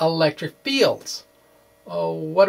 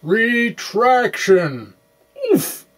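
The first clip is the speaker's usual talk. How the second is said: mock retraction; 6 semitones higher, 3 dB louder, much slower than usual. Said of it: "to say".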